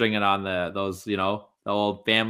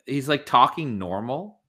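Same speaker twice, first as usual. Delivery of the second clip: ordinary delivery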